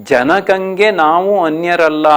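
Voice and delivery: slow verse reading; poem recitation. Shown as a demonstration. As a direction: recite the line with care. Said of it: "ಹದಿನಾರನೇ ಪದ್ಯ". (ನಿಧಾನ ಪದ್ಯ ವಾಚನ)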